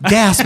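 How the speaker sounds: spoken imitated gasp, loud and high; mock crowd gasp. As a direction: gasp like a shocked audience. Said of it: "And everyone was like".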